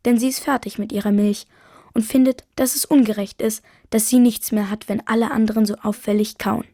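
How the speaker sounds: plain reading voice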